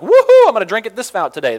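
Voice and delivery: a loud, high, joking woohoo; playfully pretending to go wild; mock party cheer. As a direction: whoop it up like a hammed-up rebel. Mock wild moviegoer, self-parody.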